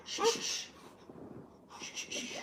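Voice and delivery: soft unvoiced shushing. Real, not acted. Hushing crying baby.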